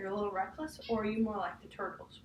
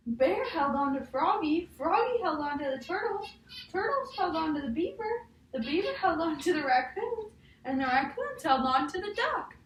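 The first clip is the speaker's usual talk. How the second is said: higher and louder, building each line; excited storybook chain; 7 semitones higher, 6 dB louder than usual. Acted. Teacher reading the ride scene to a child, loud and excited. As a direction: tumble through the chain with rising thrill.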